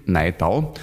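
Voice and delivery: "Neidau" with an ei sound; old-spelling pronunciation. Shown as a demonstration. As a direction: say "Neidau" as if spelt with E Y. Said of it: "früher auch mit E Y geschrieben". ("Neidau" mit ei, wie früher geschrieben)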